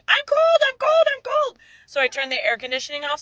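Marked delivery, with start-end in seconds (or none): 0.1-1.6 very high, imitated seven-year-old yell; mock child's yelling. Seven-year-old complaining about the air conditioning, high mock yelling. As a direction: complain loudly like an impatient kid. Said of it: "seven-year-old starts yelling".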